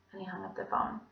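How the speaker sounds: ordinary delivery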